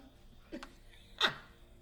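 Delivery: high short chuckle; laughing. Real, not acted. After the accent greeting, real high chuckle. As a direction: chuckle at your own bit.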